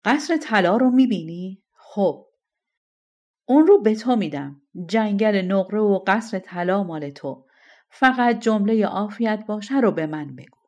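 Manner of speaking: king pleading loudly, offering gold. A narrator's reading. King offering the golden palace to the shepherd, loud pleading. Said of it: "اون گفت".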